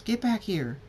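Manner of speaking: high-pitched chasing shout; high mock scream; playful scream. A narrator's reading. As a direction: call after someone in a game of chase. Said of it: "Abelin screams".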